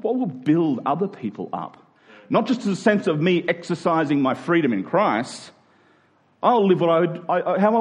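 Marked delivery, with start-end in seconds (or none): none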